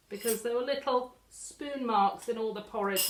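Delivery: ordinary delivery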